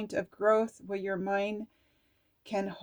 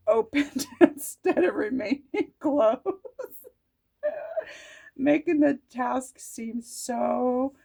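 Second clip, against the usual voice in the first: laughing; 4 semitones higher, 5 dB louder than usual. Real, not acted. Laughing while reading card.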